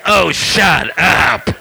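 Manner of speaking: slow, drawn-out exasperated telling-off; mock-exasperated, stretched-out rebuke; annoyed wife. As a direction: imitate a fed-up wife snapping. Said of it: "I must have been very annoying".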